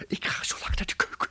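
whispered aside. Real, not acted. Professor whispers to hidden girl.

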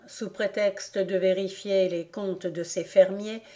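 usual speaking voice

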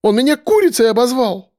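high, outraged whine; offended girl. A narrator's reading. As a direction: tattle in outrage. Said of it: "взвизгнула девчонка".

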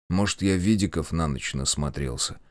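low, unconvinced musing; self-doubting. A narrator's reading. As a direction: say it as if not believing yourself. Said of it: "Сам себе не веря".

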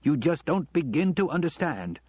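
exasperated. A narrator's reading. Husband arguing with his wife, exasperated.

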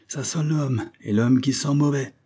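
a hint of a gruff skipper; lightly voiced captain. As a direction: warn the men behind you. Captain warns men while descending.

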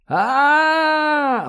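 long, very high terrified scream; drawn-out wail of terror; frantic scream. A narrator's reading. Fleeing man nearly caught by spiders, frantic high scream. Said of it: "вновь истошно закричал Ковальский".

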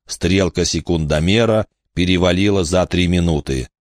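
ordinary delivery

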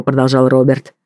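usual speaking voice

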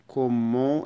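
كومون with a nasal end and no N; nasal vowel. Shown as a demonstration. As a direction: say كومون through the nose, N not sounded. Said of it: "والإن بتنطق من الأنف".

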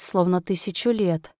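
ordinary delivery, nothing marked

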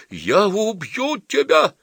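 enraged suitor howls at his mocker; yelling threat. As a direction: scream the threat at him. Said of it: "завопил Крекшин".

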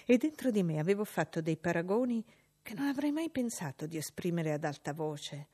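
ordinary delivery

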